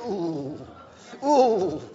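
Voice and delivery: drawn-out uneasy ooh, rising; wary ooh. Retold or imitated. Pastor wary of the offered joke, a doubtful ooh. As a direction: hesitate loudly before answering.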